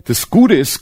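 ordinary delivery

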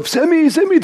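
very high-pitched urgent call; mock frantic calling. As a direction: call for help in acted panic. Ruft aufgeregt nach Sammy. (gespielt panisches Rufen, sehr hoch)

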